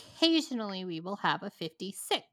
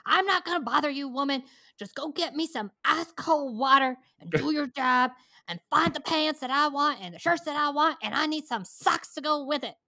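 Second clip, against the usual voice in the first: demanding customer; 6 semitones higher than usual. Retold or imitated. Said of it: "He goes".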